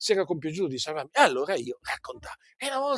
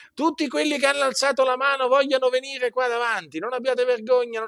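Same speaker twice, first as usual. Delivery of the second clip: higher, imitating an evangelist's altar call; mocking altar call; 9 semitones higher than usual. Mocking the altar-call preacher to his congregation, raised pitch. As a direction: ham up the preacher's invitation. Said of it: "le so a memoria perché le ho sentite".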